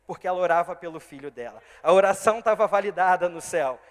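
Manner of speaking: full-throated pulpit declaration; preaching at full force. Acted. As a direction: drive it home to the congregation. Preaching climax.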